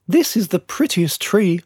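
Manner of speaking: high, announcing lady's voice; well-spoken lady. A narrator's reading. Lady choosing the prettiest tree, well-spoken and high. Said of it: "heard a well-spoken lady announce".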